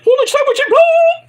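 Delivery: screaming falsetto commentator yell; very high, loud mock commentary shout; hyped commentator. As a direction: scream it like a frantic ring commentator. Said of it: "he'd be like".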